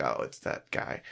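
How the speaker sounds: hushed realization. Retold or imitated. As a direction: breathe it out in a whisper, relieved. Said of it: "I was like".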